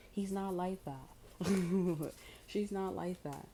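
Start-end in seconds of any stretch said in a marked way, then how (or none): 1.1-2.4 a burst of real laughter; big amused laugh; loud laugh. Real, not acted. Laughing at own bit.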